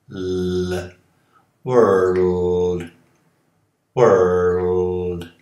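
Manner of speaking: world said slowly in three beats, L held as its own syllable; world with the L drawn out. Shown as a demonstration. Teacher slowly modelling world for Brazilian learners, L drawn out. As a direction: stretch world into wor-l-d, leaning on the L. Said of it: "Tentem falar mundo como três sílabas, dando uma sílaba no L".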